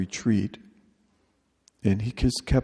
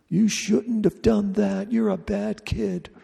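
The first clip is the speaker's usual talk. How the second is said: high, slow mock-scolding mother voice; 11 semitones higher, slower than usual. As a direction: imitate a nagging mother telling off her child. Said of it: "That's your mother still yelling at you".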